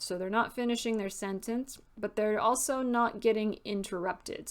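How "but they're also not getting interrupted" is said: ordinary talk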